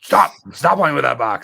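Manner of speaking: loud sharp stop at a pet; scolding a dog. Retold or imitated. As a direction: snap at a dog on the furniture. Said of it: "talked to her like she is a dog".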